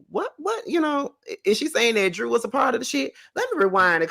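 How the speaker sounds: high, stunned questioning; wait, what is she saying; incredulous disbelief. Real, not acted. Host puzzled by Drew Sidora remark.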